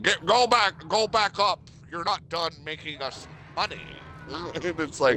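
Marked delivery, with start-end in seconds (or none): 0.0-3.8 high, snooty rich guy; bossing a worker around; posh aristocrat voice. Retold or imitated. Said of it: "And they're like".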